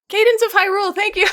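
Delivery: remembering the game title, excited. Real, not acted. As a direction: burst out with relief at remembering. Suddenly remembering the name.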